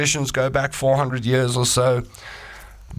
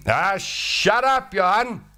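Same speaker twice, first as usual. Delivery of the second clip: high, slow dismissive retort; high slow fed-up caveman; annoyed Kippy; 11 semitones higher, slower than usual. Acted. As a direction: drag out the brush-off. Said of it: "old Kippy".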